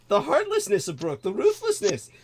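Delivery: ordinary talk, nothing marked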